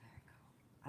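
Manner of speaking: breathy whisper to herself; whispered aside. Real, not acted. Reader whispers an aside to herself, hushed. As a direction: murmur it under your breath. Aside after reading the cards.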